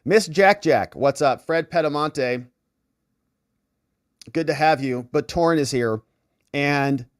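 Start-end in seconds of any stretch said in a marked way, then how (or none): none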